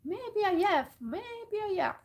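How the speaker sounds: high, loud caw on each phrase; cawing crow. A narrator's reading. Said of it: "and cawed".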